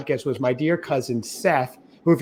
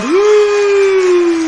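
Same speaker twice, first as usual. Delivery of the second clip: high, loud celebratory whoop; excited cheer; 8 dB louder than usual. Real, not acted. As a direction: whoop with joy. Celebrating the 150th episode.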